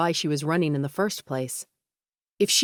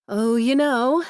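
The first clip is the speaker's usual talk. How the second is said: higher, light and breezy; airy voice; 8 semitones higher, 5 dB louder, slower than usual. A narrator's reading. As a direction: brush it off airily. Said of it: "in her best airy voice".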